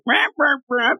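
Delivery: mock bird-man squawks, high-pitched. Fan voices the comic's bird guy, high mock squawking.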